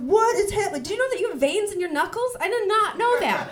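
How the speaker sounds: high, loud jittery amazement; wired panic. Retold or imitated. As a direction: sound overstimulated and freaked. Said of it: "I was like".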